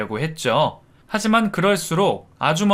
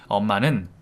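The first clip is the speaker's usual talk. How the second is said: ordinary delivery, nothing marked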